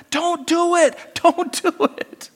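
high, shrill-pitched frantic cry of angels; mock angel screaming. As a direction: screech it like panicked angels. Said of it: "they're screaming and going".

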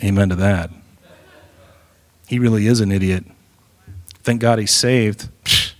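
low, dry mock-exasperated voice of the Spirit; the Spirit's voice. Preacher voicing the Spirit griping about a believer, low and dry. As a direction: grumble it like a tired, put-upon heavenly voice. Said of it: "you can almost hear the Spirit going".

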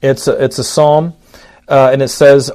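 ordinary talk

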